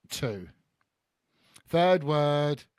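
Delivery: normal talking voice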